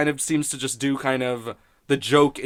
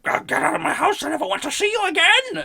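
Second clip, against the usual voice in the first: shrill, much higher mock outburst; cartoonish high-pitched anger, hammed up; mock angry Marge. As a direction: overblown retold shriek at the intruder. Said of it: "And Marge is like".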